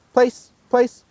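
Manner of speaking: high, loud one-word commands; dog command. Shown as a demonstration. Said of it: "I'm gonna carry on at a five".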